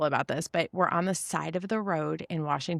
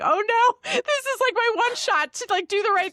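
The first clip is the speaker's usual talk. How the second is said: very high, loud retold despair; hammed-up tearful squeal, pitched way up; mock weeping wail; 4 dB louder than usual. Mom failing her one shot in front of child, mock weeping wail. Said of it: "just weeping".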